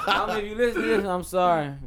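laughing apology. Real, not acted.

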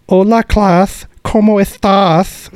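high, slow Castilian greeting with a lisp; Spanish lisp. Retold or imitated. Spanish teacher greeting her class, Castilian lisp. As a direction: sing out the greeting like a teacher from Spain. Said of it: "with the lisp".

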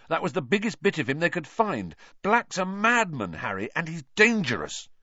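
heated, high-pitched argument; furious. A narrator's reading. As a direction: press the warning home urgently. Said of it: "said Ron furiously".

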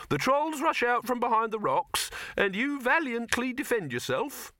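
high-pitched, patient instruction; patient explaining. A narrator's reading. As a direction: patiently spell out the scene in a high voice. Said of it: "said Silverfish patiently".

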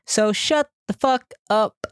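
slow, drawn-out joking insult; slow fake telling-off, teasing; mock rude. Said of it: "No, I'm joking".

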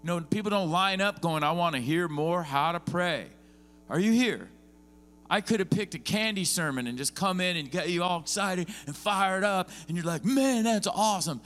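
high, loud, driving sermon delivery; fired-up pulpit preaching; preaching at full force. Real, not acted. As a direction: preach it hard to the room.

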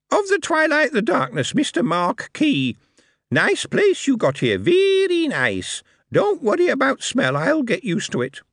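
high, cheeky goblin voice; a brash little goblin charming the host. Acted.

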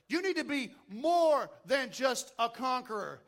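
fervent sermon delivery; booming motivational speech. Acted. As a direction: preach it out with conviction. Preaching at full force.

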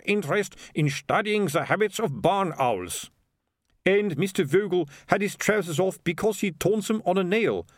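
put-on high, deliberate accented character voice; defensive mayor. Mayor excuses the windmill affair, high and defensive. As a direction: explain it away, high and measured. Mayor explains, sergeant needles.

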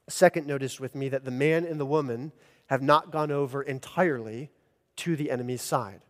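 ordinary talk, nothing marked

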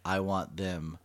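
ordinary talk

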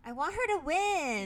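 high and loud insistence; high excited whine. Retold or imitated. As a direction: plead it loudly and high. Quoting herself to husband.

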